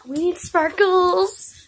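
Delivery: high, slow, loud exclamation; excited. Real, not acted. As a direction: announce it with glee. Excited idea.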